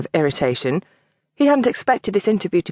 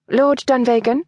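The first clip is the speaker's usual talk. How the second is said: a questioning Lord Dunvegan?, higher and rising at the end; 6 semitones higher than usual. Acted. Miss Walcott greets her visitor, a questioning rise. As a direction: ask it, checking who he is. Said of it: "she was asking".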